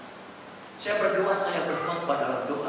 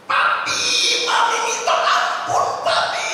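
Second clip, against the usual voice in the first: mock cry for mercy; 8 dB louder, slower than usual. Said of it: "itu ibu langsung berteriak".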